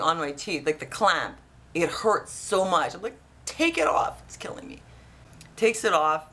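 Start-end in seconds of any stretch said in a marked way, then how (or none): 3.5-4.2 high-pitched mock urgent demand; urgent retold plea. Patient to dentist as the clamp hurts, high urgent plea. 4.3-4.8 voiceless whispered complaint; whispered mock agony. Patient under the painful clamp, whispered mock agony.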